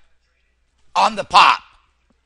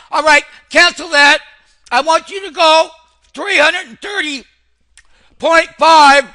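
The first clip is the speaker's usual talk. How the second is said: higher and louder, urgent trade command; excited order; 8 semitones higher, 7 dB louder than usual. Real, not acted. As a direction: snap the order out over the market noise. Excited trader barking new order.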